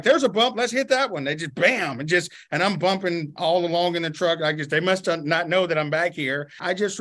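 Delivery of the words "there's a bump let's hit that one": high, teasing voice of careless drivers; mock gleeful drivers. Mocking elders driving wounded man over every bump, gleeful. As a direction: lampoon them eagerly aiming for bumps.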